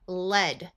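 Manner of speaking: led said with a short E like the metal. Shown as a demonstration. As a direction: say led rhyming with bed. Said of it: "With a short E".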